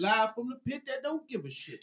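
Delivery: like talking from the bathroom; toilet voice. Real, not acted. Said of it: "I sound like I'm on the fucking toilet".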